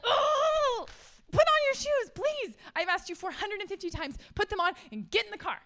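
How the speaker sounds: put-on high, loud exasperated yell at the kids; frustrated mom yelling. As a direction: snap at the kids who still have no shoes on. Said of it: "Frustration, anger, lost self-control".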